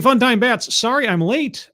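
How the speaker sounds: ordinary talk, nothing marked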